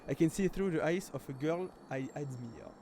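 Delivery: English read aloud with a French accent. Real, not acted. Streamer reading film subtitles to viewers, French accent. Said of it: "désolé mon accent anglais".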